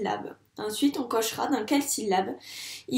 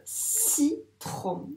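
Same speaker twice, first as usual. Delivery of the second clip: "Citron" as two separate higher-pitched beats, ci, tron; split into syllables; 5 semitones higher than usual. Shown as a demonstration. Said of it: "il y a deux syllabes".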